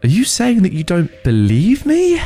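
high, incredulous question; indignant challenge. Acted. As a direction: demand an answer, affronted. Challenging a doubting partner.

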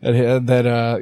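usual speaking voice

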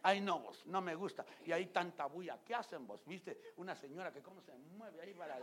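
high, loud gossipy whine; hammed-up gossip voice; mock gossiping churchgoer. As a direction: sneer about the noisy worshippers. Mocking churchgoer complaining about noise.

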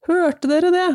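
high-pitched narrated call-out; storybook shout. A narrator's reading. A bully to his laughing gang, high read-aloud shout. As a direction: call it out to the other bullies, pitched well up. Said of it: "ropte noen av dem".

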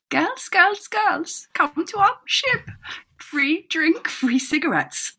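high, slow hammed-up foreign sailor call; sing-song lure from the ship; voicing the sailors' flyer inviting girls aboard; mock German sailors' flyer.

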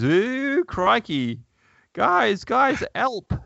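hammed-up Cockney caveman, high and yelping. Reading a caveman's panicked line to a co-host, high Cockney cry for help. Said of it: "He's got a Cockney accent".

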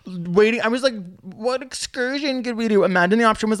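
very high-pitched incredulous enthusiasm; squeaky, exhilarated amazement; excited gushing. Real, not acted. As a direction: gush with the pitch way up. Gushing about birds surfing for fun.

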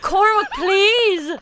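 loud, urgent plea; desperate pleading. Real, not acted. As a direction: beg him to stop. Begging not to be shocked.

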